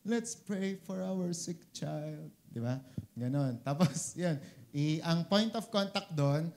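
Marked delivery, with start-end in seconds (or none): 0.1-2.3 slow, drawn-out pious prayer tone; slow mock prayer tone. Preacher imitating Oral Roberts leading prayer for a sick child, slow and solemn. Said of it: "Ganun yung tono niya".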